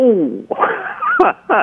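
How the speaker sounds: loud burst of real laughter; hearty laugh. Real, not acted. Lawyer reacting to caller's mother not reading, hearty laugh.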